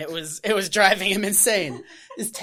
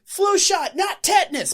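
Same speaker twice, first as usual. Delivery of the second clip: high, loud yell between blasts; hammed-up shouting farmer; mock farmer shout; 11 semitones higher, 4 dB louder than usual. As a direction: bark it like a crazed farmer. Farmer yelling between shotgun blasts.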